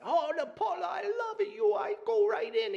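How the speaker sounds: high, frantic Italian neighbor voice; Italian accent. Retold or imitated. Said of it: "the Italian thing".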